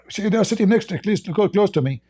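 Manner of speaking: normal talking voice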